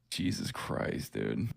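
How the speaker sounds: hushed, stunned aside; stunned whisper. Real, not acted. Shocked reaction to chatter's message.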